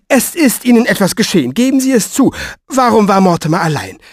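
high, pressing demand; alarmed accusation. Acted. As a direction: press them to confess the worst. Woman presses him for bad news.

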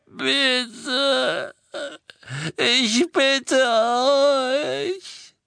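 high, very slow, crying begging; crying plea. A narrator's reading. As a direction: beg through tears, stretching every word. Prisoner begs before torture. (hohes, weinendes, sehr langsames Flehen)

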